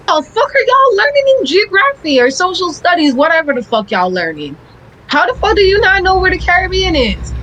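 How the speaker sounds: high, incredulous scolding; mock outrage at clueless students; exasperated rant. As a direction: let the disbelief boil over.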